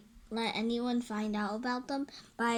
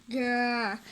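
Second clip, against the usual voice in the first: dog growl; 4 dB louder than usual. Acted.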